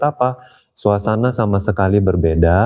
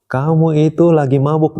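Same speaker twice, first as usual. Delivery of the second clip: mock scolding; 6 semitones higher than usual. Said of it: "Dia nggak puas".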